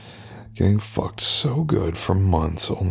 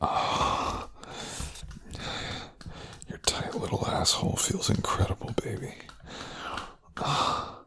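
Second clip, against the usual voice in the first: whisper; 5 dB quieter than usual. Acted. Whispered during sex scene.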